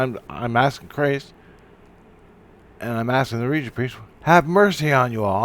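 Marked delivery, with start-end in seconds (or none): 4.2-5.4 retold scream. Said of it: "he screams out".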